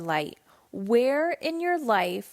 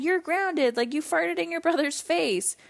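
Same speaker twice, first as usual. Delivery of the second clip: higher-pitched mock telling-off; strict parent scolding; 6 semitones higher than usual. Old-school mother punishing her son, higher mock-angry scolding. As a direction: hand down a punishment as an indignant parent, pitch raised. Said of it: "old me, or maybe an older generation".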